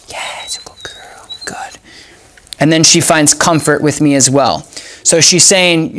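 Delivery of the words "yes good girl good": gentle whispered praise to the dog. Real, not acted. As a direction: breathe the praise, barely voiced.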